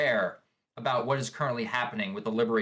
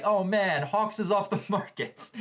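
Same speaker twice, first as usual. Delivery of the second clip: heartbroken fangirl; 6 semitones higher than usual. Retold or imitated. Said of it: "fangirls are just freaking out, just like".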